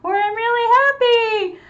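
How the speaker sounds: animated storytelling voice, worried to happy; expressive worried or happy. Shown as a demonstration. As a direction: play it big for the kids. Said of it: "sometimes I do facial expressions".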